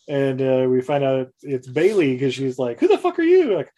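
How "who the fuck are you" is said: high, loud shocked challenge; startled demand. Retold or imitated. Woman startled by a stranger, high loud demand. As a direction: jolt up and confront him.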